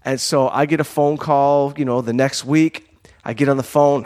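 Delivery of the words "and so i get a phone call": ordinary talk, nothing marked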